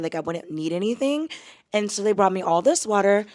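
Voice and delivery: normal talking voice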